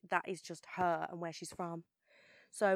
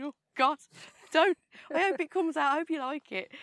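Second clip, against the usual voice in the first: higher and louder, laughing nervously; a jokey flash of stage fright, pitched up; mock panic; 9 semitones higher, 7 dB louder than usual. Telling the interviewer her inner panic, laughing nervous and high. Imagined reply to excited fans.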